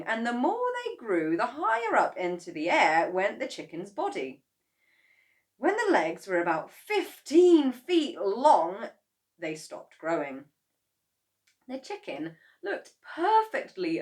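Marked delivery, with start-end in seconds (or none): none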